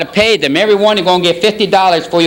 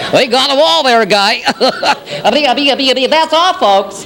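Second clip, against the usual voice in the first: high stuttering cartoon sign-off; hammed-up cartoon stutter; cartoon character impression; 6 semitones higher than usual. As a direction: stammer and chuckle into the farewell, pitched up. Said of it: "I'm gonna do the impression".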